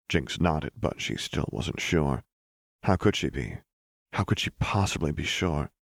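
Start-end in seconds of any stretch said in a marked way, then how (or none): none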